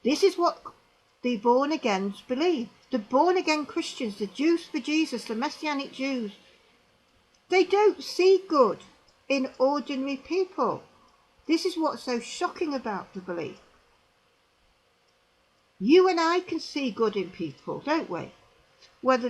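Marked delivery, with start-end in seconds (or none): none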